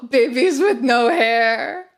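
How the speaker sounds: gasping laughing disbelief. Real, not acted. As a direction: laugh it out, amazed.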